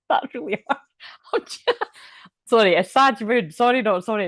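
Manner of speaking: cracking up and apologising through it; giggling helplessly at the reading; laughing. Real, not acted. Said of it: "it's coming through quite funny".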